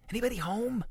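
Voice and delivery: high, questioning little call. A narrator's reading. Ruby creeps inside and checks for people, high call. Ruby calls into empty apartment.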